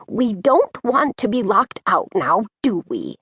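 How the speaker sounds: raspy granny voice with a falling tag question; grandmotherly rasp, pitch swooping down at the end; raspy old lady. A narrator's reading. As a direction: chide like a raspy old woman. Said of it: "in her raspy old lady voice".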